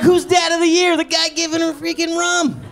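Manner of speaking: high, loud bragging yell; boastful shout. Retold or imitated. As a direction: brag like a champion. Bragging about dad of the year.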